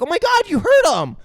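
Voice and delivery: high, loud imitation of an upset child; mock distressed girl. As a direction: cry out in mock alarm. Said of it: "Drew's like".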